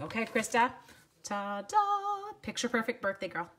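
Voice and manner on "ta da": high "ta-da" flourish; singsong reveal. Real, not acted. Crafter showing viewer the stamp set, singsong reveal. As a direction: sing it out, second note held high.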